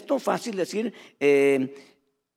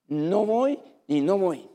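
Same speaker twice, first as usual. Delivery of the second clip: defiant refusal; slower than usual. Retold or imitated. Rebel's open defiance of Moses.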